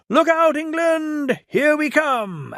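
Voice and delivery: high, slow, gleeful call; excited cheer. A narrator's reading. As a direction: call out in triumph as they set off. Engines whistle off excitedly.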